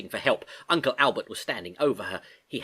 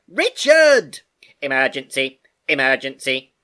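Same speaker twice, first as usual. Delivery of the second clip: alarm call; 2 semitones higher, 11 dB louder than usual. Acted. Computer raising the alarm in the dive, loud. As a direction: blare the warning. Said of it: "Richard called out".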